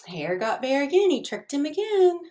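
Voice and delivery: ordinary delivery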